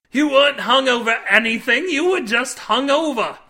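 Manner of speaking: high, loud indignant correction; exasperated shouting. A narrator's reading. Cade correcting Nick, exasperated shouting. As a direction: spell it out to fools.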